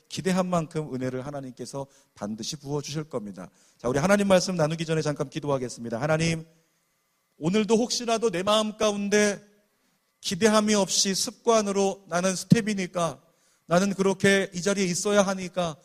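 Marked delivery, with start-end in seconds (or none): 3.8-15.7 loud, earnest prayer voice; fervent prayer. Acted. Pastor opening prayer for the congregation, loud and earnest. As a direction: pray it out over the crowd.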